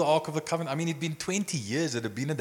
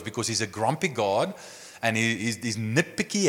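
ordinary delivery

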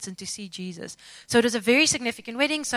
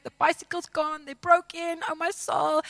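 retold crying; 6 semitones higher than usual. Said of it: "and I'm crying".

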